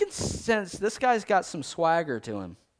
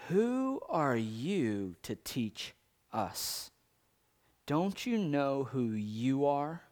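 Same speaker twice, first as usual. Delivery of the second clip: haughty hush; 3 semitones lower, 7 dB quieter, slower than usual. Retold or imitated. Voicing haughty leaders, hushed and slow.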